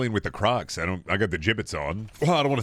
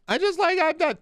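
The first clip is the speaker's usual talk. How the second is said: squeaky falsetto. Acted. Sudden squeaky bit.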